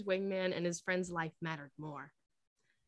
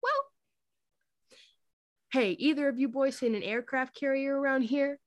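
higher, louder joking question; 7 semitones higher, 5 dB louder than usual. A narrator's reading. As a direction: tease them like it's nothing. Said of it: "Maverick jokes".

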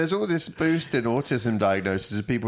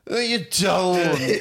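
higher, slow, laughing mock protest; insecure veteran; 9 semitones higher, much slower than usual. Friend mocking the insecure veteran, high and slow. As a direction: send up the touchy veteran. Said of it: "the idea of the insecure veteran".

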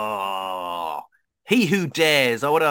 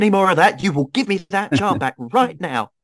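loud, higher in-character threat; stern demand; 9 semitones higher, 6 dB louder than usual. Acted. Adventurer demanding the stolen charm back, loud angry threat. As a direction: lay down an angry ultimatum.

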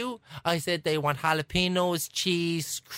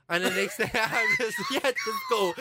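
higher, words carried on laughter; laughing; 5 semitones higher than usual. Real, not acted. Retelling the boss's dismissal to friend, laughing. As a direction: tell it while giggling.

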